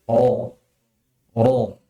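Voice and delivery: heavy, thick R in "Ro", tongue root lifted. Shown as a demonstration. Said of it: "pangkal lidah itu naik".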